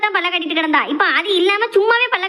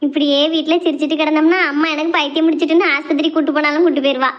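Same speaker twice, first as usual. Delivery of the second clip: normal talking voice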